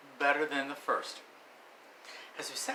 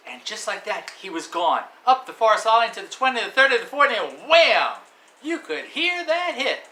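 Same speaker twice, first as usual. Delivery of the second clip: louder, higher, racing to the big hit; excited play-by-play; 8 semitones higher, 11 dB louder than usual. Acted. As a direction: call the run like a live commentator.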